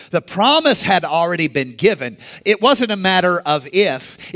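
ordinary talk

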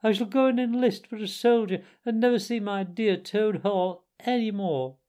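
high-pitched, plaintive wail; acted sobbing. A narrator's reading. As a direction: bewail it dramatically. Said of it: "sobbed the Toad".

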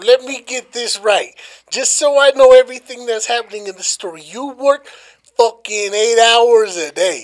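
incredulous recap. Real, not acted.

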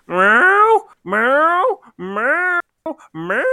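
high, loud pleading cat meows; drawn-out distressed cat meow. Retold or imitated. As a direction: wail the meows like a cat locked out. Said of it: "like a cat meowing and a cow mooing".

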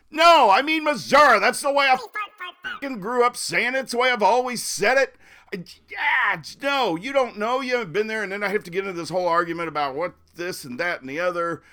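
loud, high-pitched indignant rant; exasperated outburst. Retold or imitated. Native arguing over his state's name, exasperated rant. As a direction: blow up at being corrected, then race on. Acted quarrel over pronunciation.

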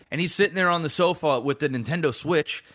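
ordinary talk

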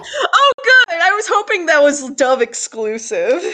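high-pitched, gushing relief; real delight. Real, not acted. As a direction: burst out high, thrilled by the joke. Delighted at hearing the dub joke.

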